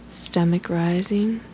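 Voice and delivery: ordinary talk, nothing marked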